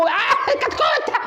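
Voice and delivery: very high, sharp cry of alarm; high startled yelp; shocked shriek. Acted. Shriek as chick is grabbed.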